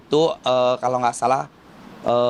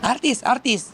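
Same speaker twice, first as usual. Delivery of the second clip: mimicking locals calling out artis, artis in a higher voice; excited recognition; 10 semitones higher, slower than usual. Locals in Mecca spotting the celebrity, excited calling. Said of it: "mereka langsung lihat kayak".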